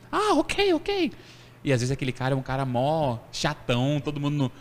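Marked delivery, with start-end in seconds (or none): 0.1-1.1 much higher, softer charmed "ok, ok"; the grumpy official won over. Retold or imitated. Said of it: "o cara já dá aquele sorrisão". (funcionário encantado, voz bem aguda)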